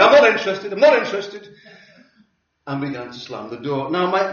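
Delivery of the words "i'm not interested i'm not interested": loud, dismissive refusal; loud brush-off. Retold or imitated. Householder turning away church visitors, loud brush-off. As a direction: shut them down as you slam the door. Man slamming the door on visitors.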